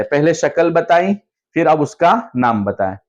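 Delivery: usual speaking voice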